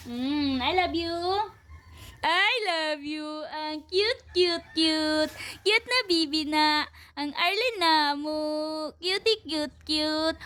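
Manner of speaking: slow cooing "I love you" to a cute little pet. Real, not acted. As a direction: melt over the pet with love.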